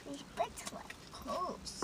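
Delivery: whispering, slow and breathy. Real, not acted. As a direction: lean in and whisper it. Whispered comment while eating.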